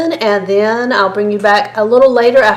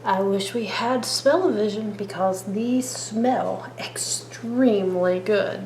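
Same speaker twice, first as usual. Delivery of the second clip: whispering; 6 dB quieter, slower than usual. Real, not acted. Tasting fresh bars on camera.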